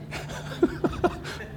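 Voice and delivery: real laugh at own joke. Real, not acted. Speaker admits writing the joke last night, laughing. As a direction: crack up at your own wordplay.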